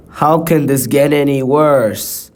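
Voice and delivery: normal talking voice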